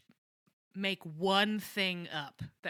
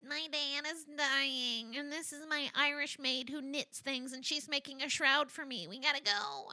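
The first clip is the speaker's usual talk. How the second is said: fake distraught act; 6 semitones higher than usual. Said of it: "she's like".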